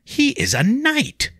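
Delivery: hesitant high reminder; high, slow insinuating lady. Acted. Leah hints at Vincent's rank, high and slow. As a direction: let the hint hang, slowly.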